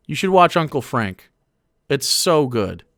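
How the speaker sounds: usual speaking voice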